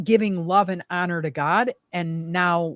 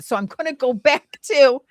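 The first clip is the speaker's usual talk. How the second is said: ordinary talk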